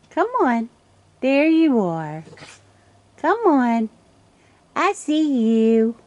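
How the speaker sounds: high, loud drawn-out coax; slow high coaxing voice; coaxing call. Real, not acted. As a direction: lure them in, loud and high. Coaxing raccoons closer.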